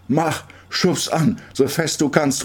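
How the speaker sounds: high, drawn-out command; urging call. A narrator's reading. Girl on swing demands pushes, calling out. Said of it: "ruft sie".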